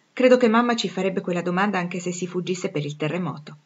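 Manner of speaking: ordinary delivery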